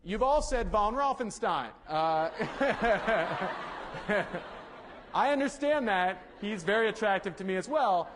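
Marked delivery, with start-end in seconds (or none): 2.3-5.1 helpless, sustained giggle; giggling. Real, not acted. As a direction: break into a long laugh. Amused by audience's guesses.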